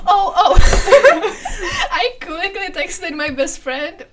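laughing through the words, slowed down. Real, not acted. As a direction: talks while still giggling. Laughing while starting a story.

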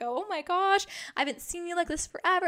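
imitating his excited reaction; thrilled boyfriend. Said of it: "You're like".

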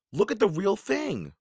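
high, urgent plea to look. A narrator's reading. Annie pulls Jack from his drawing, urgent. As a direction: raise the pitch and press the point.

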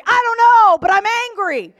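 loud, high exasperated retort; imitating someone irrationally angry, voice leaping up; mock angry outburst. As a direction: shout it back like a stubborn grudge-holder. Voices angry person asked why.

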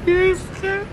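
high, laugh-broken pleading; half laughing, half crying from nerves; scared laughing. Real, not acted. Begging his friend to stop the creepy act, nervous laugh-crying. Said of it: "I'm about to cry".

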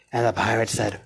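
ordinary talk

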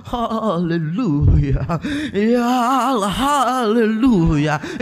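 mock fervent prayer, drawn out very slowly; high quavering prayer. Imitating the former pastor praying, quavering drawn-out praise. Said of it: "intonasinya tinggi, terus nadanya bergetar-getar".

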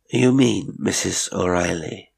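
ordinary delivery

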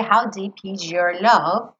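"is your" said as "ijor"; J sound in is your. Shown as a demonstration. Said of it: "volta naquele J".

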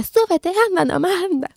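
quoting a boyfriend's urgent order, lightly voiced; urgent retold command. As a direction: push her to ride away fast. Scar urging her on.

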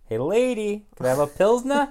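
loud, high Boston customer voice; mock Boston accent. Retelling the bar customer's reply in a mock Boston accent. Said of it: "Guy's from Boston".

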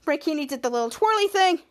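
ordinary talk, nothing marked

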